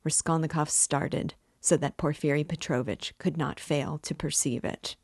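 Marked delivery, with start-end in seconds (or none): none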